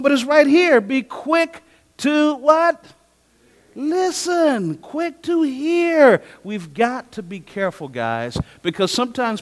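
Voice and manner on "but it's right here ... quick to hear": slow, very high, loud verse call; preaching at full force. Acted. Pastor calling a Bible verse to the congregation, slow and soaring. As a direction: stretch each word out to the crowd.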